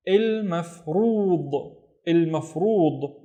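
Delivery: il mafrood said slowly, syllable by syllable; slow syllable demo. Shown as a demonstration. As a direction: pronounce il mafrood slowly and clearly. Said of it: "The first syllable is".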